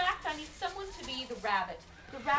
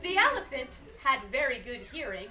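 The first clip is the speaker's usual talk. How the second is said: ordinary delivery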